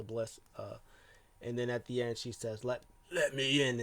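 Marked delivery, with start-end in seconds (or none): 2.6-3.8 lightly imitated monster voice; a hint of a creepy character voice; Fiend's voice. Alexa at the end speaking as the Fiend, lightly imitated. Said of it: "in the Fiend's voice".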